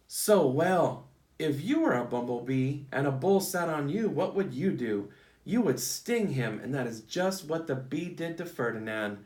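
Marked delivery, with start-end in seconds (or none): none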